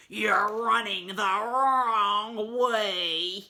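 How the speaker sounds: loud, slow menacing shark; mean shark. A narrator's reading. Mister Shark scaring the dogs, slow menace. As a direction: stretch it out as a loud threat. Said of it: "gets his mean face on and says".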